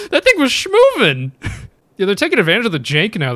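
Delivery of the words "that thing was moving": high, loud, slow, laughing amazement; excited. Real, not acted. Player reacting to the ghost's speed, excited. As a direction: marvel at how fast it moved.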